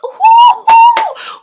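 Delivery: very high, loud whooping laugh; excited whoop. Real, not acted. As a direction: burst out in a piercing hoot. Breaks off laughing mid-story.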